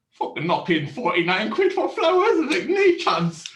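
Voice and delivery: high, loud laughing disbelief; laughing scoff. Retold or imitated. Husband laughing at the florist's price to himself.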